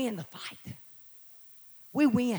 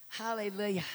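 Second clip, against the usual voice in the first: usual speaking voice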